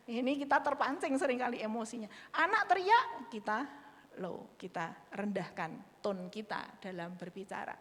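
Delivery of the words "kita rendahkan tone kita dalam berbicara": usual speaking voice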